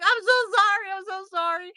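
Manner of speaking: high-pitched retold apology; frantic apology. Patient who dug nails into therapist's hand, apologizing frantically.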